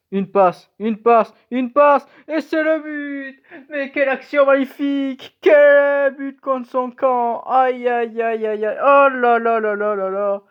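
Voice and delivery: high, loud commentator voice; excited sports commentary. Acted. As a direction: call the own goal like a gleeful TV commentator. Calling own-goal like a commentator.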